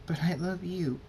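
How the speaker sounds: high, pleading protest; lightly acted tearful voice; tearful plea. A narrator's reading. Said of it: "Tears appear in".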